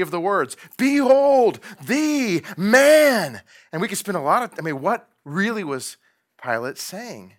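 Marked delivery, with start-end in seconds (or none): 0.8-3.4 high, loud and very slow proclamation; slow proclaiming. Shown as a demonstration. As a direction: ring it out word by word. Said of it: "break that up into its three parts".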